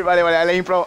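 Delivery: ordinary talk, nothing marked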